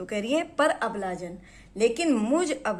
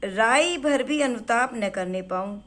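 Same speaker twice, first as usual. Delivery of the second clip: poem recitation; 5 dB louder than usual. Acted. Recites verse line.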